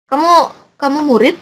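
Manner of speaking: "Kamu, kamu murid" as a clearly questioning example; question tune. Shown as a demonstration. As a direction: ask "Kamu, kamu murid" with lifted pitch. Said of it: "Itu berarti ditanya".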